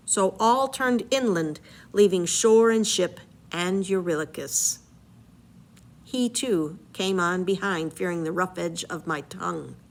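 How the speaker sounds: story narration, read aloud with energy; dramatic reading. Acted. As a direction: read the epic aloud to the class. Teacher reads Odyssey aloud.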